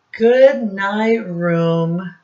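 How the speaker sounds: slow, loud storybook line; slow read-aloud. Acted. Teacher reading the book to children slowly.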